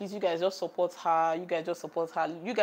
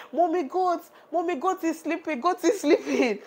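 put-on high, sing-song little girl voice, much higher; toddler daughter; 10 semitones higher, 5 dB louder than usual. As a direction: play the small daughter cheerfully pointing at the goat. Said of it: "after she was saying".

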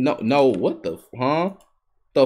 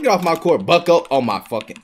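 ordinary talk, nothing marked

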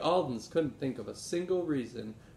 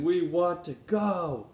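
louder, slower and higher, a drawn-out plea; eager pleading; 4 semitones higher, 6 dB louder, slower than usual. Acted. Benny pleads with Grandfather to go to the rally, eagerly. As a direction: beg like a child who can't wait. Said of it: "Benny exclaimed".

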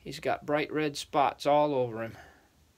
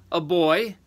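raised, louder giant voice; giant answering; 5 semitones higher, 7 dB louder than usual. Acted. First giant answering the third, matter-of-fact.

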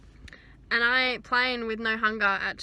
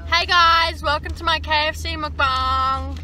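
loud, bright YouTube-style welcome; excited vlogger intro; 4 semitones higher, 6 dB louder than usual. Pretend. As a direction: hype up a fake mukbang opener. Mock mukbang intro to camera.

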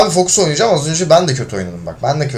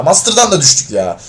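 usual speaking voice

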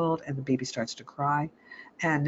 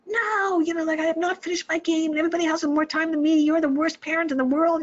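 much higher, louder, fast whiny protest; whining twelve-year-old; 13 semitones higher, 5 dB louder than usual. Retold or imitated. Twelve-year-old protests losing his game, high whiny and loud. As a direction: whine like a kid cut off his game. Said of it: "is saying".

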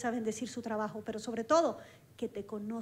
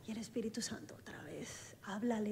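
whispered, far softer, as a quiet inner voice; hushed whisper; 4 semitones lower, 11 dB quieter than usual. Retold or imitated. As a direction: breathe the words like a secret prompting. Holy Spirit's voice in testimony.

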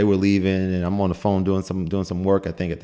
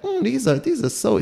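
put-on high, delighted little old lady; sweet old lady. Old lady tasting the cookie, high and delighted. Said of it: "she's like".

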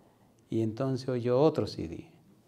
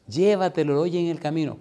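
high, louder motherly nagging; insistent urging; 5 semitones higher, 5 dB louder than usual. Retold or imitated. Mother urging son to listen on the road, insistent. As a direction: keep pressing, voiced as a mother.

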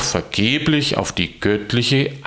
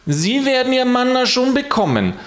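much higher, prim saintly voice; Petrus answering; 13 semitones higher than usual. Acted. Petrus brushes off Alois, prim and high. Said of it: "sagte Petrus".

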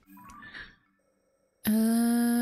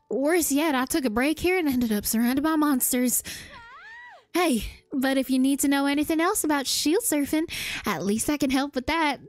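chatty southern villager offering help; southern accent; 2 semitones higher than usual. Acted. Former shield surfer offers advice to the hero, southern accent. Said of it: "I started doing a southern accent".

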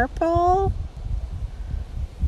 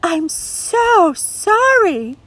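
sorry; 11 semitones higher, 4 dB louder, much slower than usual. Acted. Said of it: "Sally Jane said".

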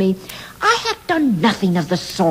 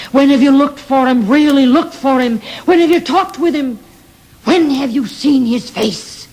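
rising, insistent questions pressed higher; pleading challenge; 6 semitones higher than usual. Acted. Godly Fear challenging the silent townspeople, insistent and pleading. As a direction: demand an answer they cannot give.

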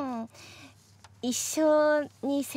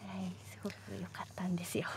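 whispered, fast and secretive; a quick secretive whisper; hushed whisper; 10 semitones lower, 14 dB quieter, much faster than usual. Real, not acted. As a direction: lean in and whisper it so nothing is spoiled. Hushed to avoid spoilers.

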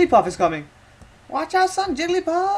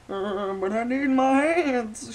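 put-on old man voice; 4 semitones higher, 4 dB louder, slower than usual. Old man character pleads.

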